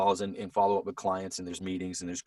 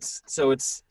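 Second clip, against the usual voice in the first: ordinary delivery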